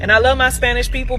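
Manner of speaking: ordinary talk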